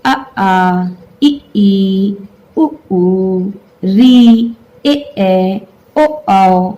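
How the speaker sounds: अ आ इ ई उ ऊ री ए ऐ ओ औ spoken one by one, each vowel kept separate; reciting vowels. Shown as a demonstration. Teacher modelling the vowel series for students, clear and separate. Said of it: "बोलिए".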